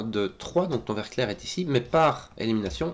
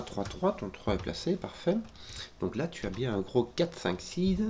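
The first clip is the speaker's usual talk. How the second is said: ordinary delivery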